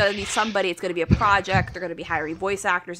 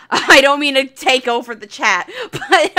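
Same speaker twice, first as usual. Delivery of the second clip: louder, laughing through the words; 5 semitones higher, 9 dB louder than usual. Real, not acted. Apologizing to the hosts while laughing.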